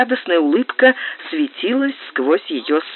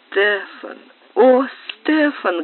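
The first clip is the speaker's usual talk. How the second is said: drawn-out, high dreamy call; sleep-talking; 6 semitones higher, slower than usual. A narrator's reading. Clara calling Stefan in her troubled sleep, dreamily pleading. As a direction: call out slowly from inside a dream. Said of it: "говорила во сне Клара".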